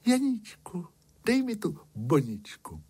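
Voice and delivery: high, light princess voice; lilting high plea; princess's request. A narrator's reading. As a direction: ask in a high-pitched girlish princess voice. Princess asking shepherd for posy.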